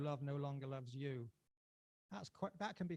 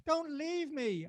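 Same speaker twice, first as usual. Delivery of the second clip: high, slow plea; pleading; 13 semitones higher, 10 dB louder, much slower than usual. Acted. Lover begging the girl in the street, pleading. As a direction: beg her not to go. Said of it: "I plead".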